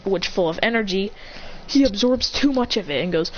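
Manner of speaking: ordinary talk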